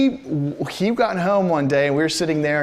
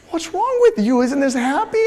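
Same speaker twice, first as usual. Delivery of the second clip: high-pitched mock disbelief; exasperated wife; 8 semitones higher, 4 dB louder than usual. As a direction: challenge him, imitating a baffled wife. Acted exasperated wife challenges husband.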